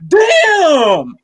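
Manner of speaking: high, loud yelping dog voice; excited dog voice. Pretend. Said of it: "The dog is like".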